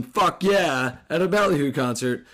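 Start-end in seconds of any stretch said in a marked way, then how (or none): none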